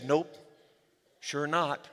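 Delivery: easy and unimpressed, drawn out; calm. Retold or imitated. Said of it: "He said".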